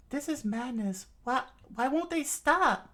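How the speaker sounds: put-on high voice, pleading loudly at the end; distressed cry. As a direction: cry out in acted dismay. Distraught character amid the fighting.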